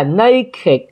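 put-on naked in two syllables, NAY-kid; ịch ending. Shown as a demonstration. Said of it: "âm ịch".

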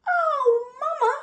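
high, loud wail; alarmed cubs' cry. Acted. Lion cubs cry to mother, high and alarmed. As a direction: wail for Mama like a little cub. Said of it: "cried the lion cubs".

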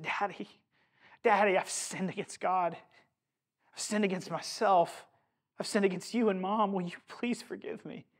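softly voiced tearful plea; hushed, mildly tearful imitation of a penitent daughter; retold sobbing confession. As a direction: plead softly, as if retelling through tears.

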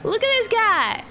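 high, loud gleeful exclaim; delighted shout. Real, not acted. Gamer shows off her new dino, loud and high. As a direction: shriek with joy at the new figure.

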